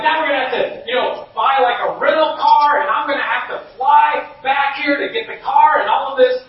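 venting frustration at the broken car; retold anger. Father fuming at the dead car, loud angry rant. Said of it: "I start getting mad and angry".

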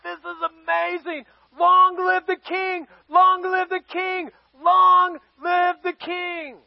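high, drawn-out chant of acclaim; crowd acclamation. Acted. Crowd acclaiming the new king.

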